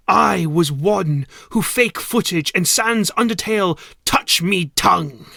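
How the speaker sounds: loud, put-on character voice confessing; Pikachu voice. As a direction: boom out the confession in a creature voice. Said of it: "said Pikachu Pocket Monster".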